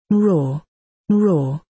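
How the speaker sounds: lightly voiced hoarse cat roar; a hint of a cat growling from the throat; hoarse throaty cat. Old cat greeting the boy from behind, mild throaty roar. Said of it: "a hoarse throaty voice said".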